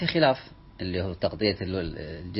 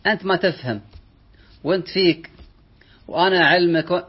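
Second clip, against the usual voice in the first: scornful lecturing; 9 semitones higher, 7 dB louder than usual. Retold or imitated. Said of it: "وقت في مجالس".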